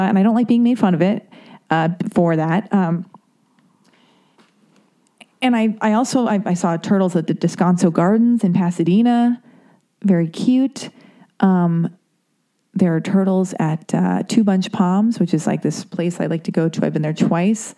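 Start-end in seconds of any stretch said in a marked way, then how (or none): none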